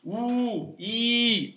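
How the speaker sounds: OU and I each held long; long vowels. Shown as a demonstration. Teacher listing the long vowels, each one sustained. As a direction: draw out "ou", then "i", separately. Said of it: "les voyelles longues".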